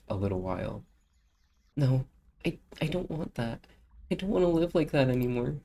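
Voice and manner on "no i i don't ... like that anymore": higher, pleading refusal; a touch of tearful girl; distressed girl. Acted. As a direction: refuse with rising despair. Voices character upset.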